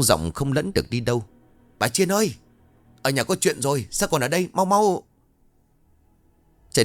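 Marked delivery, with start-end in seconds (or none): 1.8-5.0 high, breathless hurry; urgent calling. A narrator's reading. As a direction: call her in a rush. Young man calling urgently. (gọi gấp gáp, giọng cao)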